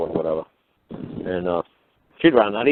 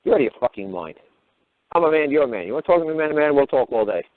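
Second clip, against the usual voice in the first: ordinary talk, nothing marked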